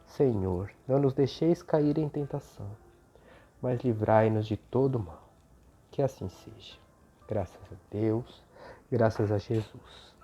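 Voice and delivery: soft, devout prayer voice. Acted. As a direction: pray gently and reverently. Opening prayer over music.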